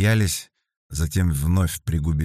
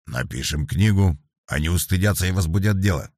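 usual speaking voice

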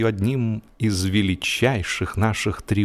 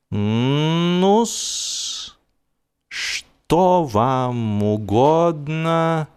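slow, mild cat voice; a polite, questioning cat, drawn out; meek cat; 5 semitones higher, much slower than usual. A narrator's reading. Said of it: "кротко посмотрел".

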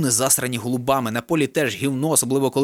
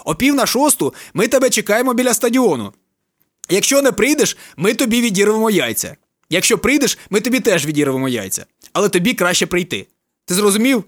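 shouted orders; 6 semitones higher than usual. A narrator's reading. Friends giving orders on phone.

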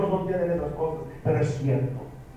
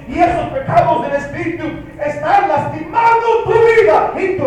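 louder, rising fiery sermon with shouts; preaching at full force; 6 semitones higher, 9 dB louder than usual. Acted. (predicación a todo pulmón, subiendo hasta gritar)

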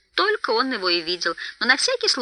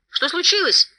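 higher-pitched call into the dark; raised questioning call; 9 semitones higher than usual. A narrator's reading. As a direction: call across to someone unseen. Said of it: "окликнул его Бильбо".

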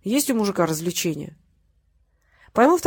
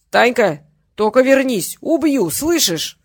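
loud, raised shout, dragged out at the end; drunken threat; 4 semitones higher, 6 dB louder, slower than usual. A narrator's reading. Drunk father shouts after his daughter on the stairs, threatening. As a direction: yell the threat after someone leaving.

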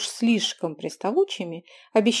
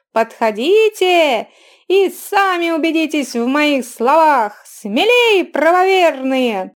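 piercing, slow, loud hawker's cry; loud carnival barker; 5 semitones higher, 5 dB louder, slower than usual. A narrator's reading. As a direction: call out to the whole bazaar crowd. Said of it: "снова звонко заголосил".

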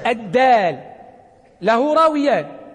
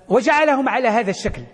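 ordinary talk

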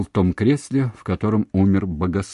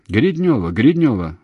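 loud, raised panicked call; frightened shout; 3 semitones higher, 7 dB louder than usual. A narrator's reading. Man shouting for her as the line drops, frightened. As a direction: call out in alarm down a dead line. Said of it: "страшно испугавшись, закричал Устименко".

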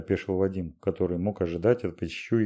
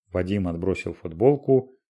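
usual speaking voice

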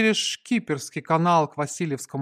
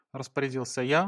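plain reading voice

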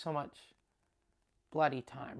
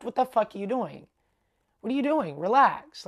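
loud, raised retold outburst; indignant scolding; 5 semitones higher, 7 dB louder than usual. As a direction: jump in and tell him off loudly. Girls pop up to scold him.

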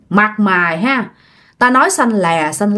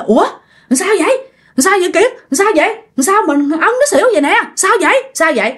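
alarmed questions; 9 semitones higher, faster than usual. Retold or imitated. Friend rushes up, alarmed questions.

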